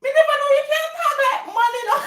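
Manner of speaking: very high, fast, laughing squeal; dad laughing in surprise. Retold or imitated. Dad amazed at his daughter's man voice, squealing laughter. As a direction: squeal it in amused disbelief. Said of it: "He said".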